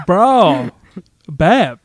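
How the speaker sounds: mock teen dude talk. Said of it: "thirty-five-year-old dudes writing teenage, young adult dialogue".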